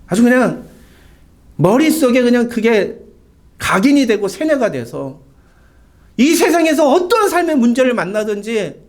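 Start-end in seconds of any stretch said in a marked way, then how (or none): none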